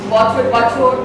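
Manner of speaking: normal talking voice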